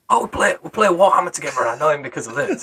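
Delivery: high-pitched, over-keen mimicry; imitated eager blurting. Friend blurting out their shared hobby at work, imitated eagerness. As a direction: mimic someone cheerfully giving it away.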